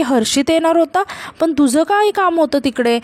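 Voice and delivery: plain reading voice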